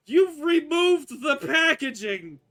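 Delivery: high, full-volume shriek of outrage; angry kitsune. Acted. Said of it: "you see an angry Kitsune".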